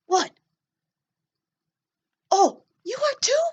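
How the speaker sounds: put-on high, bright little-boy puppet voice; puppet kid voice. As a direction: play a nervous little boy talking to the class. Teacher voices puppet Joe for kids.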